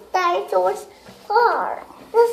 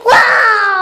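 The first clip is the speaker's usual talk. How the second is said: put-on child's loud lion roar; 5 dB louder than usual. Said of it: "good roar".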